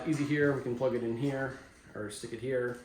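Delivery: ordinary talk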